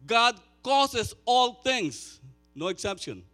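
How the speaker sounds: high, loud, fervent preaching; preaching at full force. Acted. Pastor preaching Romans eight loudly to the congregation. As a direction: drive the promise home to the room.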